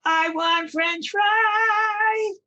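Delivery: very high, very slow operatic squeak; tiny child voice. Retold or imitated. Little daughter answering in opera at dinner, tiny voice. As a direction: sing it in a tiny little girl voice. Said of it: "this little tiny voice went".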